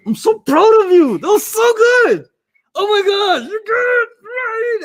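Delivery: high, gushing fake praise; teacher mock-praising a wrong answer, voice way up; over-the-top praise. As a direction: gush sarcastically like a proud parent. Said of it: "I'm gonna say".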